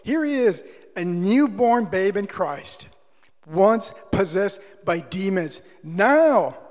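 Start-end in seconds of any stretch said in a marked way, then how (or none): none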